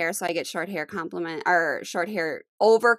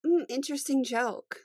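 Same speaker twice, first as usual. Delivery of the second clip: higher, slightly distasteful hum first; disdainful comeback; 5 semitones higher, 4 dB quieter than usual. Shown as a demonstration. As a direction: say it as if smelling bad fish. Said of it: "I just smelled like bad fish".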